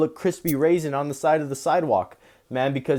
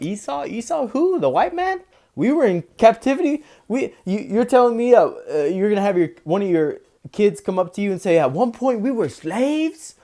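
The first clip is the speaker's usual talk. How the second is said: much higher, louder incredulous questions; mock disbelief; 7 semitones higher, 4 dB louder than usual. Imagined elect in the kingdom mocking Esau's claims, high and incredulous. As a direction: scoff as if the idea is absurd. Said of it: "be like".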